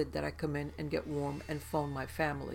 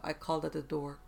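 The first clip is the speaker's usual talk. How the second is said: ordinary delivery, nothing marked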